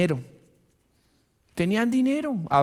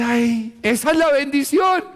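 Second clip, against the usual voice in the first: mock covetous believer; 7 semitones higher, 4 dB louder than usual. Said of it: "usted dice".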